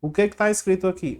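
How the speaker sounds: ordinary talk, nothing marked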